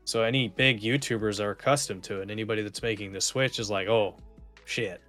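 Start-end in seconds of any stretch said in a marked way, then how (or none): none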